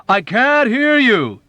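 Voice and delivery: high, loud, slow bland voice; amiable Arfie. Acted. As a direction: shrug it off cheerfully.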